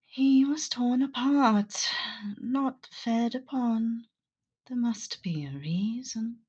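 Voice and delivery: high, slow voice fading into whisper; character voice. Acted. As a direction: muse slowly in character, trailing into a whisper. Plays Aang examining the body.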